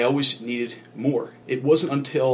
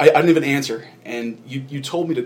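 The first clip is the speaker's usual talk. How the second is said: ordinary talk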